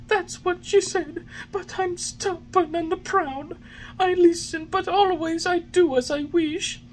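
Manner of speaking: put-on high, wailing puppet boy sobbing for his friend; sobbing bitterly. A narrator's reading. Pinocchio over his fallen schoolmate, sobbing bitterly. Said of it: "Sobbing bitterly, he called".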